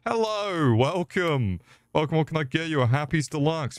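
high, sugary customer-service voice; fake cheerful cashier. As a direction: switch on a bright fake-polite welcome. Fake-sweet cashier greeting customers.

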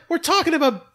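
high, loud incredulous plea; exasperated disbelief. Retold or imitated. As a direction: viewer pleads with a stone-faced actor, exasperated. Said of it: "I was like".

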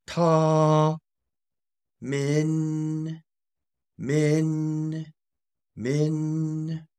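"ta" high and flat, then "min" held high; tones of tamen. Shown as a demonstration. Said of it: "so that one is going to be".

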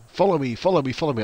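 mock-insistent begging, starting high and loud; imitated pestering follower. Streamer imitating someone who wanted followers, mock pestering. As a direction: imitate a follower pleading over and over. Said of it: "I had one yesterday who was like".

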